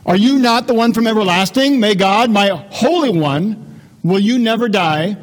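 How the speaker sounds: a prophet's forceful plea; impassioned complaint. Acted. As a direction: cry out to the Lord in protest. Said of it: "Habakkuk's got some more complaints".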